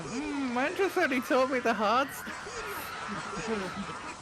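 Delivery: high, slow mock mouth-full mumble. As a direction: mimic talking through a mouthful. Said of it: "with your mouth full, going".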